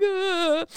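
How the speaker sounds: high pretend whimper; mock tearful sigh. New mother sipping tea in bed, mock tearful whimper. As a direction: whimper it like the tail of a cry. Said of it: "I was all like".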